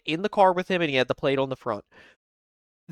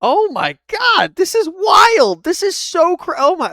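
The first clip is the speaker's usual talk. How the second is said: much higher and louder, slow, stunned exclamation; shocked outburst; 7 dB louder, slower than usual. Real, not acted. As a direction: shriek in disbelief at the clip. Shocked reaction to arrest video.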